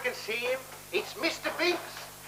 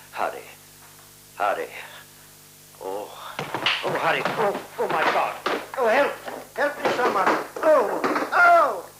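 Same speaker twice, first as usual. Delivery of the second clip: growing panic; 3 semitones higher than usual. Acted. Alone and terrified in the room.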